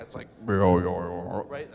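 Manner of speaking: low, slow stomach growl; belly growl. Shown as a demonstration. Priest imitating a growling belly for the children, low and slow. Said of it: "Let me hear".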